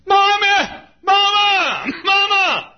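put-on loud, high desperate child's shout; child calling. Lost child screaming for his mama, loud and high. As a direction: wail for mama like a lost boy.

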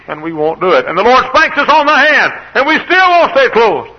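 high, loud, exasperated pulpit shout; full-force preaching. Acted. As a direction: shout it, building in pitch.

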